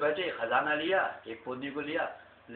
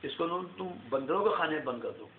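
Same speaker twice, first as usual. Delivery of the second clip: ordinary delivery, nothing marked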